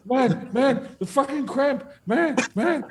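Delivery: very high, strained cries of agony; mock screaming. Sonny cries out about his cramp, mock screaming. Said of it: "And he's screaming".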